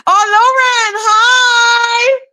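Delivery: high, drawn-out squeal of hello; sing-song delighted shriek; excited greeting. Real, not acted. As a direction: gush at a friend who just turned up. Greets a viewer joining live.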